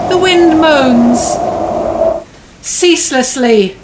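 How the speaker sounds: dramatic story narration. A narrator's reading. Dramatic narration of Hamlet scene.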